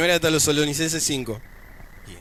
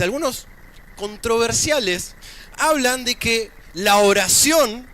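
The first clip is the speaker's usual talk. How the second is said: higher-pitched, projected sermon delivery; preaching; 8 semitones higher, slower than usual. Acted. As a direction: preach it in measured, emphatic phrases with pauses. Preaches to the congregation.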